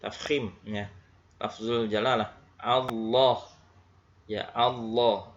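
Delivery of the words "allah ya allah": "Allah" with a thick L and a dark O-like vowel; heavy lam. Shown as a demonstration. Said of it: "Maka harus ditebalkan".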